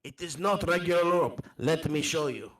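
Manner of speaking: animated foreign storyteller, lilting pitch; accented traveller. Acted. Telling Walt of goblins in the marshland, accented traveller. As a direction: spin the tale with a foreign accent. Traveller shows off the magic rope.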